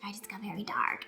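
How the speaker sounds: hushed, voiceless confession; whisper. Retold or imitated. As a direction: breathe it like a secret. Quoting the writer's dark confession.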